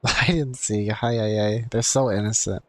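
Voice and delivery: usual speaking voice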